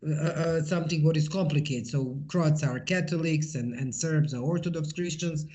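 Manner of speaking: ordinary talk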